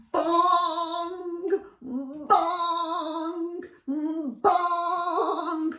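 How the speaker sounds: high loud ringing bell bongs; sung bell bongs. A narrator's reading. Narrator ringing the church bell for children, loud and high.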